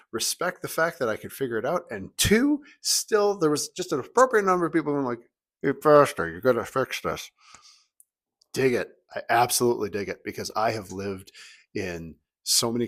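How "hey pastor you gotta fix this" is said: lightly put-on voice of a congregant; imitated church member. Congregant flagging a mistake to the pastor, mock complaint.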